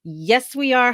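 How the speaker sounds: usual speaking voice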